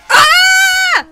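sudden high piercing scream; startled scream. Real, not acted. Player screaming as a crocodile lunges. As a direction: scream in real fright.

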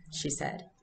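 ordinary talk

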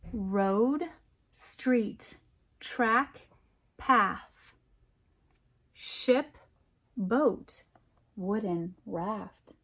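slow, lilting picture-book reading; poem read aloud. A narrator's reading. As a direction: read it like a bedtime rhyme for kids.

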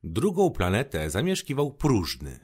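usual speaking voice

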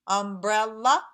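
"Umbrella" in three beats with a final lift; "Umbrella" firmly divided in three; syllable by syllable. Shown as a demonstration. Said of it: "Three syllables".

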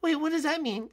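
put-on high sweet girly voice; Birdo voice. To Yoshi in the morning, high sweet Birdo voice. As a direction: pitch way up as a sweet girlfriend.

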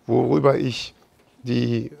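ordinary delivery

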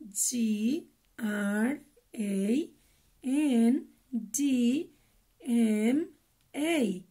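letters one by one, slowly; slow spelling. Shown as a demonstration. Teacher spells for kids, slow and clear. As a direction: spell S I S T E R out for children. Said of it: "practiquemos un poco cómo deletrear".

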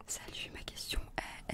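ordinary delivery

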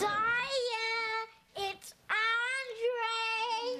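high, drawn-out singsong call. Acted. Child calling sister to the phone, drawn-out call. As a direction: call across the house, stretching the names.

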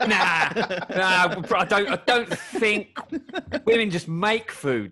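sarcastic mock bro. Host mocks crisp marketing that ignores women, loud mock-bro. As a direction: play the clueless ad man, loudly.

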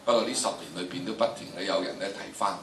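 normal talking voice

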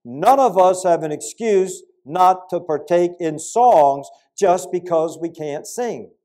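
normal talking voice

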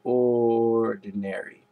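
ORDINARY stretched very slowly; drawn-out word. Pretend. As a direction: drag out "Ordinary" at half speed.

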